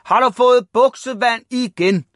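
high, loud angry question; very loud shouting. A narrator's reading. Father to Ivan about wet trousers, shouting. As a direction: roar it at your son. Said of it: "begyndte lige med det samme at råbe meget højt".

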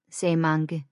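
ordinary delivery, nothing marked

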